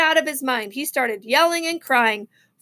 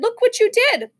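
high-pitched motherly scolding; exasperated, raised-pitch reproach; dismayed mother; 9 semitones higher, 3 dB louder than usual. A narrator's reading. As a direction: exclaim in dismay at the mess. Mother scolds messy birthday boy.

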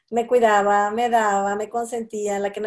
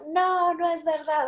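put-on higher, slow, whiny little-girl protest; tantrum little girl; 9 semitones higher, slower than usual. Student insists she's not smart, whiny childish protest. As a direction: play the sulking child refusing to believe it. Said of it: "la niña sale con el berrinche".

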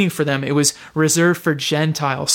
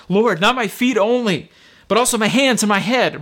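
higher-pitched, eager plea; comically overeager; 5 semitones higher than usual. Acted. Peter asking Jesus to wash all of him, comically overeager. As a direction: blurt it out, keen to get everything offered. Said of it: "our scene has its first humorous moment".

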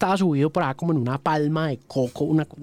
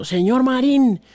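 higher, military formal salute on air; formal soldier greeting; 8 semitones higher than usual. Retold or imitated. Soldier greeting the radio host on air, higher and formal. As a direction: address the host like a soldier reporting.

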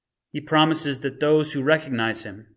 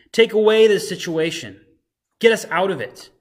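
higher, louder desperate plea; pleading criminal; 5 semitones higher, 6 dB louder than usual. Retold or imitated. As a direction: beg to be rescued, voice raised. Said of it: "The first criminal pleads to Jesus".

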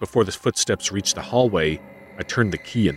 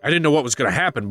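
ordinary delivery

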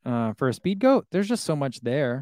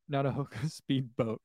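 breaking into laughter on Speed Boat; slow down and crack up saying "Speed Boat"; amused; 6 dB quieter, slower than usual. Real, not acted. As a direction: laugh bubbling through the words. Amused by the misnamed shoe.

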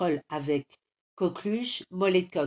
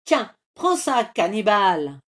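fighting cry; 2 semitones higher, 7 dB louder than usual. Acted. Hero hitting the troll, battle cry. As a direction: strike with every word. Hits the enemy.

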